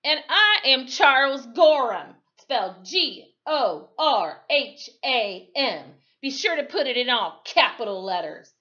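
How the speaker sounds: loud, high, gloating spelling out; triumphant. Acted. Gorham gloating at the sheriff as he spells his name, loud. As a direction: savour every letter in triumph. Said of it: "Gorham said triumphantly".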